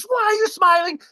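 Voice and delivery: high, loud indignant yell; imitated outrage. Soaked woman yelling at the man on the beach, mock outrage. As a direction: demand it in mock fury. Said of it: "she is mad as a hornet".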